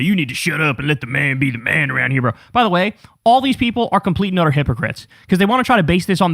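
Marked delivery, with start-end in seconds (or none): 0.0-2.3 macho man. Retold or imitated. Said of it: "pretend to be all, like, macho man".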